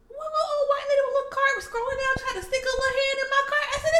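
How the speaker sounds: high, loud, fast outraged telling; excited retelling. Retold or imitated. Telling a friend about the cart thief, fast and high. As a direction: rush it out, outraged.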